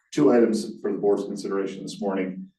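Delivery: normal talking voice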